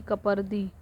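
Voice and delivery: ordinary delivery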